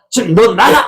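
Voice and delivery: high-pitched angry order; mock a parent throwing the child out. Angry parent ordering a child out of the house, angry. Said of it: "집 나가라고 화가 나면".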